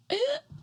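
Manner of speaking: very high gasping hiccup; sudden squeaked intake; imitated hiccup. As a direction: mimic a hiccup that won't stop. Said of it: "it just keeps happening".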